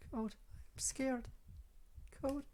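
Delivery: put-on high, soft, timid girl's voice; small, high, halting and hushed; frightened Yuri. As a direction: plead softly in a high, fragile voice.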